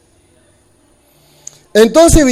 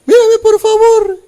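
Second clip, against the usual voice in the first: pleading; 13 semitones higher, 4 dB louder than usual. Acted. Begging Jesus to look.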